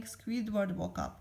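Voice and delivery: ordinary delivery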